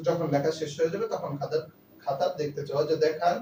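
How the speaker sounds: normal talking voice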